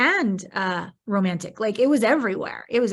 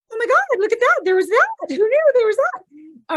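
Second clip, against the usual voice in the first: much higher and louder, astonished; amazed exclamation; 14 semitones higher, 8 dB louder than usual. Retold or imitated. Retelling her body's surprise to a friend, high amazed cry. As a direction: marvel aloud at a hidden discovery. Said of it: "my body, I'm like".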